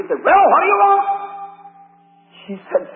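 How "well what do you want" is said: loud, high, impolite demand; brusque, raised-voice grouch; rude shopkeeper. Acted. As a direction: challenge the customer with no courtesy at all. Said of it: "He didn't go out as a polite".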